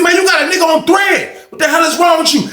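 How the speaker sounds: high, hammed-up disbelief; high mock outrage at the friend. Homeboy outraged in retold story.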